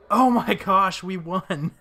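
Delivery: high, loud shriek of joy; shrieking jackpot winner. Real, not acted. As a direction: shriek like you just won. Winning the match.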